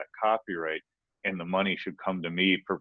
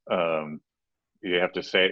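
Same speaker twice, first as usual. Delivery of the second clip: normal talking voice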